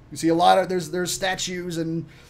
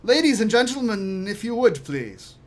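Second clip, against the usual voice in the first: high, projected formal announcement; lilting servant's invitation; servant summoning; 5 semitones higher, 5 dB louder than usual. Acted. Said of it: "Kemed steps out and says".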